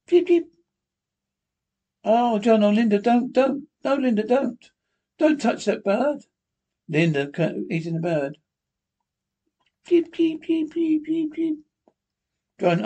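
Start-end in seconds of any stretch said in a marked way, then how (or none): 0.1-0.5 squeaky high chirping peeps; tiny high-pitched peeping; high bird peeps. Acted. Bird sound in radio script. 2.0-6.3 pleading. Acted. John pleads with Linda. 9.9-11.6 squeaky high chirping peeps; tiny high-pitched peeping; high bird peeps. Acted. Bird peeping before being eaten.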